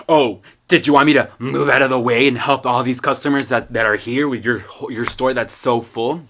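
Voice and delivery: loud mock-indignant retort, slowing at the end; sarcastic customer, imitated. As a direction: play the rude customer laying on sarcasm. Rude customer's retort, acted.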